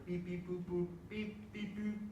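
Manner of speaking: chirpy beep boop calculator noises. Pretend. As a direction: play at pressing calculator keys. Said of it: "Get calculator".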